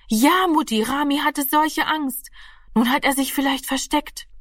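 high, anxious outburst to mother; alarmed child. Acted. Daughter tells her mother Rami was scared, high and alarmed.

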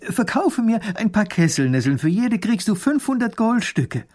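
eager little grand duke. A narrator's reading. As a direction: bargain eagerly in a high voice.

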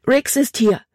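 hoch und laut, atemlos vor Angst; stoß die Warnung verängstigt hervor; ängstlicher Ausruf. A narrator's reading. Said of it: "rief sie aus". (Mädchen warnt den Vater, hoch und ängstlich)

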